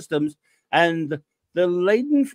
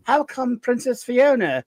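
higher put-on whiny voice of a jealous fan; mock aggrieved listener; 6 semitones higher than usual. Host voices a listener jealous of Fiona, hammed-up whine. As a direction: read it as a sulky complaint. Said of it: "Max asks".

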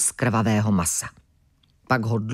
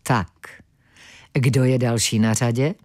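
icy calm; 4 semitones lower than usual. Acted. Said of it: "s ledovým klidem pronesl".

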